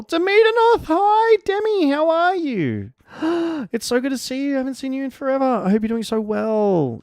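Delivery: high, gushing squeal of hello; excited greeting. Real, not acted. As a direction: greet a long-missed friend in a high squeal.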